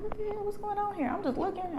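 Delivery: high, soft, under-the-breath questioning; hushed nosy disbelief. Retold or imitated. Onlooker nosing at church drama, hushed high disbelief. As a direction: quietly wonder in a high, small voice. Nosily watching a scene in church.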